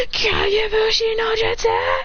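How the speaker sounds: high, drawn-out angry jutsu call; pissed battle cry. Acted. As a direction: stretch the attack name into an angry high yell. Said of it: "he gets even more pissed".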